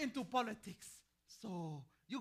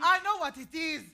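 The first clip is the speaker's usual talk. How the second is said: sharp retort; 6 semitones higher, 10 dB louder than usual. Acted. Old woman snapping back.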